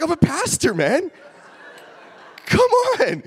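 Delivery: very high, loud comic squeal; incredulous protest. Retold or imitated. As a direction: protest in a squeaky, indignant shout. Acted protest at guard's accusation.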